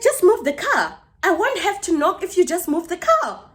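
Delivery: high-pitched mock yell; retold shouting. Neighbour demanding the car be moved, mock shouting. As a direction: imitate an exasperated neighbour raising her voice. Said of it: "you're shouting at me".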